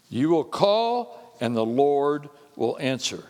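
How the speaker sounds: loud, slow scripture proclamation; preaching. Acted. Preacher reading verse nine to the congregation, slow and loud. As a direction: declare it slowly to the church.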